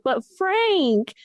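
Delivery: high, drawn-out mock whine; imitating upset child. Young son protesting to mom about Frank, mock-crying. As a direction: plead like a kid about to cry. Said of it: "like, cried 'cause he's like".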